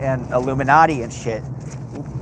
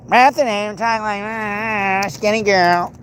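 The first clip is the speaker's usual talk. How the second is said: mock skinny girl; 8 semitones higher, slower than usual. Mocking a reality show cast member.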